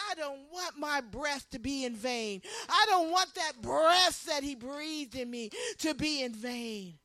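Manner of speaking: ordinary talk, nothing marked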